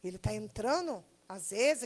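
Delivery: ordinary delivery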